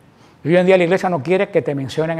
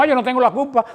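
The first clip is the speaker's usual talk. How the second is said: excusa quejosa; 6 semitones higher than usual. Retold or imitated. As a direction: imitate someone ducking blame. Acted excuse people make. (excusa quejumbrosa, imitada)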